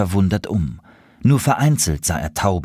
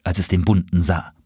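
plain reading voice

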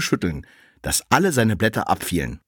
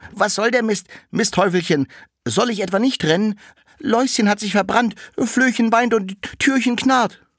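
much higher, louder toy-like cart voice; the little cart asks the door, high and louder; 12 semitones higher, 4 dB louder than usual. Acted. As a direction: ask it brightly like a little wagon. Cart answers the dung heap.

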